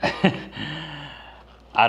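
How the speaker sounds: slow, laughing through the words. Real, not acted. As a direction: giggle it out, slowly. Laughing after trying a mukbang.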